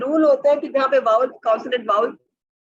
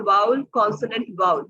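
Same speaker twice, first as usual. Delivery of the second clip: ordinary delivery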